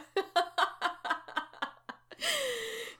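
long high-pitched laughing fit; real helpless laughter; uncontrolled giggles. Real, not acted. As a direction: crack up before you can start. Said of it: "c'est sûr que je ris".

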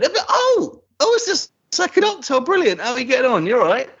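high, breezy, unbothered greeting; mock cheerful soldier, high and casual; cheery Benton. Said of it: "Benton's just gone".

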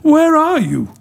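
high, searching plea; despairing cry. A narrator's reading. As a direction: call out pleadingly, pitched right up. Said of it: "cried Eco in despair".

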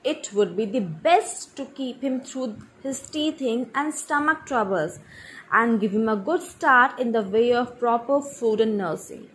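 ordinary delivery

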